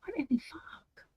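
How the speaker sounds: high, breathy whisper of disbelief; exasperated whispered what the fuck. Real, not acted. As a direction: whisper it, baffled by the screen. Tech trouble, whispered exclamation.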